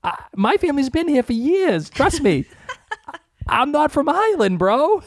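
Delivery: high, insistent, half-laughing protest; exasperated retelling. Retold or imitated. Retelling a clerk mix-up, high exasperated protest. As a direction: insist you're not from Ireland. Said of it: "I'm like".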